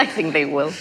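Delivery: laughing through the words. Real, not acted. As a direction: say it while laughing. Echoing the line with laughter.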